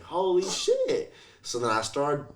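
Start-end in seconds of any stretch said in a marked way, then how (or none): 0.0-1.1 amazed realization. Retold or imitated. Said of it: "I'm like".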